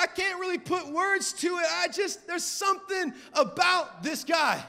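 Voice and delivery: imitated tearful struggle to speak; high, halting mock-crying voice; Simon Cowell. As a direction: choke out broken phrases, as if crying. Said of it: "And he's crying. He's like".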